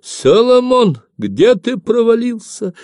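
very high, loud wail; long, high-pitched granny yell; screaming old granny. A narrator's reading. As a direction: cry out like an anxious old woman. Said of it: "закричала старая бабка Самойлиха".

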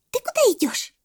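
very high monkey voice; questioning monkey. A narrator's reading. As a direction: squeak out the question. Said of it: "спросила слонёнка мартышка". (вопрос мартышки)

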